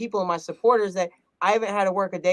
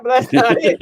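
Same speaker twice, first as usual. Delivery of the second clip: laughing; 6 semitones higher, 9 dB louder than usual. Real, not acted. Laughing repeat of the critique.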